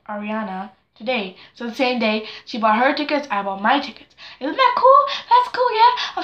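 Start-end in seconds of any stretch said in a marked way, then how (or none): 4.4-6.2 high excited squeal. Real, not acted. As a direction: squeal it up high like a thrilled fan. Excited about buying tickets.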